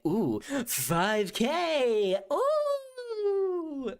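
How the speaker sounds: high drawn-out ooh of delight; excited squeal. Real, not acted. Reacting to a card reveal with a high squeal.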